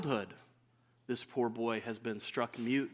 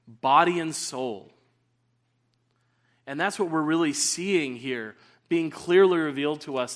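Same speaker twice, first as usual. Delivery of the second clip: forceful preaching; 8 dB louder than usual. Acted. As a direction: preach it out with full force, louder.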